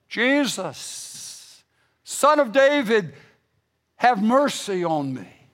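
crying out. Acted. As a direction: call out like a beggar desperate to be heard. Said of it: "he began to cry out".

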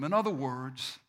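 ordinary talk

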